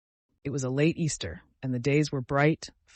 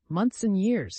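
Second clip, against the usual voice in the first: a higher, bright exclaiming burst; 6 semitones higher, slower than usual. Acted. Dying son exclaims to the doctor, delighted exclamation. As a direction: toss the words up in delighted wonder. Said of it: "he would exclaim".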